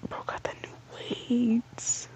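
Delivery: breathy whisper, almost silent. Real, not acted. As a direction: hush it right down. Whispers to himself.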